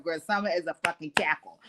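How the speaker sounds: usual speaking voice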